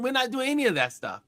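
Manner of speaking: ordinary talk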